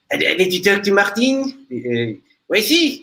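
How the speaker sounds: irked French caller, starting high; imitated impatient French accent; Gerard Butler. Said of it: "getting pissed off".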